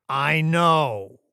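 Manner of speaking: loud, high, imperious voice; condescending boss. Acted. Boss dismissing an employee's objection, condescending and loud.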